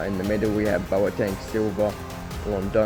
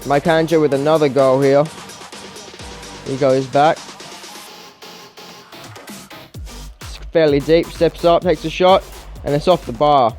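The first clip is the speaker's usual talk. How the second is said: higher-pitched, animated match commentary; live play-by-play; 6 semitones higher, 6 dB louder than usual. Real, not acted. Gamer calling his team's comeback, live play-by-play. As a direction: call each chance and goal as it happens.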